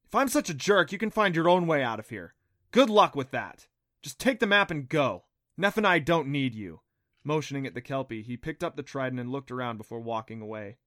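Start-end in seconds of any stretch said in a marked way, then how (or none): 0.0-6.8 louder, bitter snapping back; boy telling her to leave; angry retort. Acted. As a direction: hit back with wounded anger. Adam's hurt angry retort.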